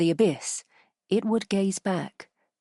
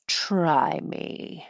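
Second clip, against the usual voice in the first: sweet on top, acid underneath; acid-sharp sweetness. Acted. Falk daring the grot, sweetly sharp. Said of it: "with acid-sharp sweetness".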